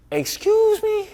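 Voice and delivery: very high, incredulous "excuse me"; shocked disbelief. Retold or imitated. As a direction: squeak it out in disbelief. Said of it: "I was like".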